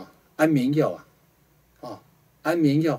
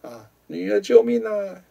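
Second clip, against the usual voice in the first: high, slow pleading cries of help; a dragged-away mother begging her daughter, stretched out; mother crying for help; 8 semitones higher, slower than usual. Retold or imitated. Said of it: "对着女儿说".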